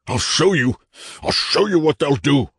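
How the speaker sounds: high, strained, crazed declaration; frantic insistence. A narrator's reading. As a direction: insist wildly, desperate to prove it. Madman frantic to prove himself.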